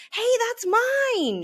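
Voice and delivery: high, loud, slow protest; indignant shout. A narrator's reading. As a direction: shout it from the floor. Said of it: "shouts Schubert from the floor".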